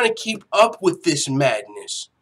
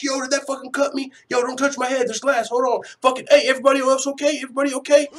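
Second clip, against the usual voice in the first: higher, hurried, mock-panicked checking; acted alarm; 7 semitones higher, faster than usual. Fighter on a glass-covered bus checking on others, acted alarm. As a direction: play someone startled and checking for injuries.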